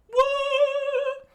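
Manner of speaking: very high, loud falsetto; squeaky startled what. Acted. Character caught in the avalanche, in a squeaky yelp.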